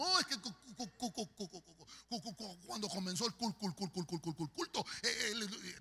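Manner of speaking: hammed-up stuttering churchgoer; panicked stammer. Church sister trying to tell the pastor what happened, comic stammer. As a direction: get stuck repeating syllables until the word comes out. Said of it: "¿Me está hablando lenguas".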